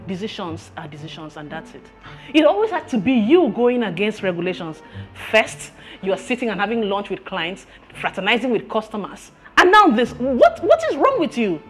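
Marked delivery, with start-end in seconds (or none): 0.1-1.8 low, soft dismissal; cold finality. Acted. Boss closes the matter of firing. 9.6-11.6 high, loud exasperated outburst; exasperated scolding. Acted. Boss lists employee's faults angrily.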